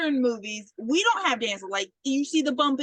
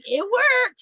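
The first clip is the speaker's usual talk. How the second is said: high, loud mock defiance; unrepentant retort; 10 semitones higher, 6 dB louder than usual. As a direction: imitate her proudly defending the result. Poisoner brushes off horror, retold.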